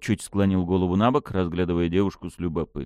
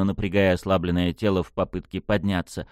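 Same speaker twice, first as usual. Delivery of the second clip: ordinary delivery, nothing marked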